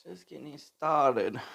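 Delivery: slow drawn-out repeat; slow drawl. Real, not acted. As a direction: stretch each word out lazily. Repeats slowly to himself.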